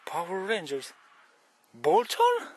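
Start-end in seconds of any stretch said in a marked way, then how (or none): 1.7-2.5 a sudden squeaky cry of amazement; high surprised squeal. Real, not acted. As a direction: shoot way up in disbelief, loud. Shocked to see Bolton mentioned.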